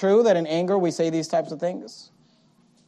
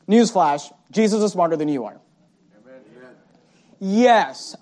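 normal talking voice

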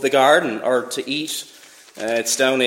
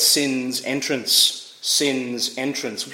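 slow emphatic heading; 3 semitones lower, much slower than usual. Acted. Preacher repeats heading slowly.

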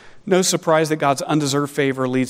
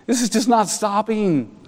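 mock exasperation; 6 semitones higher than usual. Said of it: "You're like".

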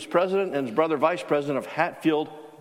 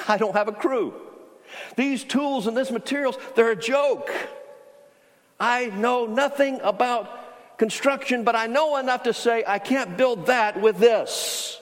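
higher, slow, exasperated plea; exasperated protest; 7 semitones higher, slower than usual. Retold or imitated. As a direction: spell out the absurdity, dumbfounded. Dumbfounded worker protests to Mark.

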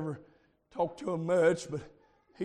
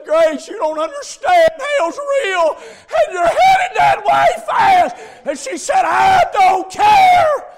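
high, shouted retelling of an argument; impassioned revival storytelling at a shout; preaching at full force; 13 semitones higher, 16 dB louder, slower than usual. Real, not acted. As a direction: build to a loud, drawn-out cry.

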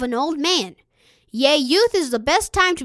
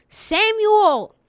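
higher-pitched narrated call; 6 semitones higher, 3 dB louder than usual. A narrator's reading. Holy voice calls Samuel a third time, calling out. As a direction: call the name out, mildly raised. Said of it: "the holy voice cried".

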